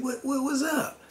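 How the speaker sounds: pitched sharply up in confusion; baffled. Retold or imitated. Narrator asks Mark what's going on, high and baffled. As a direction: stumble over the question, way up high.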